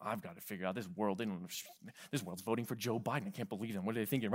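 a sneering aside from a pompous believer. Retold or imitated. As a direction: mutter it like a self-righteous know-it-all. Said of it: "they come across so like".

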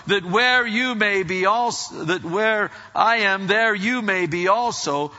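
loud, high-pitched Bible reading; scripture proclamation. Acted. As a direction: proclaim the verse at full force. Reads scripture at full force.

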